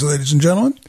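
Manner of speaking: ordinary talk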